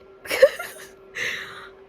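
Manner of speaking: a squeaky, tearful laugh; high crying laugh. Real, not acted. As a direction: laugh and sob together. Overwhelmed by the ending.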